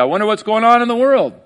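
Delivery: high, loud, eager wondering as you grab your phone; eager morning wonder. Retold or imitated. Waking up and grabbing the phone, high excited wonder. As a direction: voice the phone-checker's excited curiosity. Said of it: "you can grab your phone and go".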